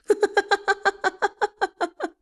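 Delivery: high manic cackle; teacher laughing maniacally in chains. A narrator's reading. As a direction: laugh like a madman. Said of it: "he laughed maniacally".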